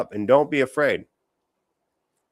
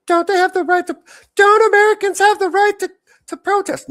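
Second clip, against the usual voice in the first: squeaky, much higher and louder mock voice; mocking the questioner; 9 dB louder than usual. Streamer ridiculing a reporter's protest question, high squeaky mockery. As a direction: parrot the question like a shrill idiot. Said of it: "I fucking hate this argument".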